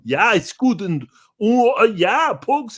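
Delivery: loud, high German guy voice; German accent. Retold or imitated. German campers heard in background, mock German accent. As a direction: boom it like a jolly German camper. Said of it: "German guys in the back going".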